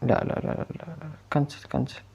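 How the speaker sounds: whispered, rapid no no no; panicked whisper. Real, not acted. Gamer startled by the horror game, whispering panic. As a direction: hush it out in sudden panic.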